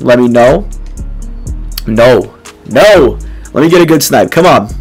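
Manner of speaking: ordinary talk, nothing marked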